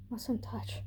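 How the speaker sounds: a barely voiced, fearful whisper; hushed whisper. A narrator's reading. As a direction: whisper the old warning to yourself. Frightened girl repeating a rule.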